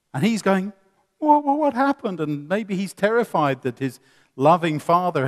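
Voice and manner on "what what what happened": put-on high, bewildered little boy; startled child. Toddler son just dragged from the road, bewildered and high. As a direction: stammer it out, confused.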